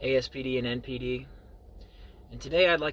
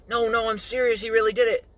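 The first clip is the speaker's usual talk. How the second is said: put-on higher, louder old lady protesting; insistent old lady; 10 semitones higher, 8 dB louder than usual. Old lady insisting to the mom the boy did it. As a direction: insist she's telling the truth, voice pitched up. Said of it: "The old lady says".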